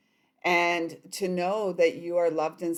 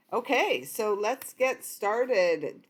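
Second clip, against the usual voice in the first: usual speaking voice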